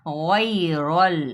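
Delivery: गैरल slowly with a heavy र; thick ra. Shown as a demonstration. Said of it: "मोटा पढ़ा जाएगा". (गैरल को मोटी रॉ से पढ़ना)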